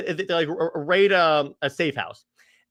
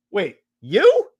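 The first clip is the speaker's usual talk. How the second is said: louder, rising double-take; mock surprise; 3 semitones higher, 5 dB louder than usual. Reunited pair recognizing each other, acted surprise. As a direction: act out a startled recognition. Acting startled reunion with Natasha.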